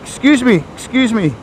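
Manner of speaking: high, polite acted call; calling for attention. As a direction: hail the shopkeeper eagerly. Customer calling the shop man.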